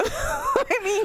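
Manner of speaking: squeaky high laugh through the words; giggling it out; real laugh. Real, not acted. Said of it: "laughing at own story".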